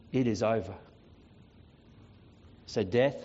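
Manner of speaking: low, measured dismissal with deliberate pauses; quiet final authority. Acted. Jesus dismisses Death and signs off, quiet final authority.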